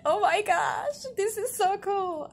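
very high, loud fangirl squeal. Retold or imitated. Gushing to viewers over a favorite game scene, fangirling. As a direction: squeal it with drawn-out, giddy delight. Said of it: "I'm fangirling".

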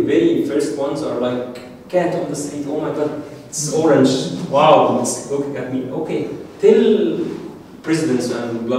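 ordinary delivery

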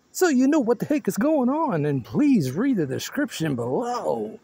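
usual speaking voice

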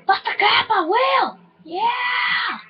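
high, pumped-up yell; hyped shout. Real, not acted. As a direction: shout it like you're going for it. Hyped shout while sniping.

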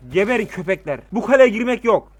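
furious yell at the enemy; angry shouting. Real, not acted. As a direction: shout it out, fierce and loud. Commanding troops in siege game.

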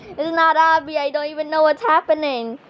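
normal talking voice

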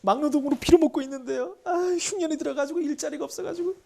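high, loud hammed-up wailing; mock crying. Poor peasant tells the king of famine, mock crying. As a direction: wail it out like a tearful pleading peasant, overacted. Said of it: "이렇게 울고 있는 거야".

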